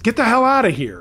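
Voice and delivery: high-pitched narrated yell. A narrator's reading. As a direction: yell like a dad chasing off intruders, slightly contained. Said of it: "my dad was running out onto the porch yelling".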